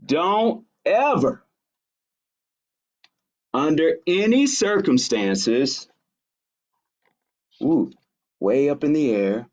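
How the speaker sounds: ordinary delivery, nothing marked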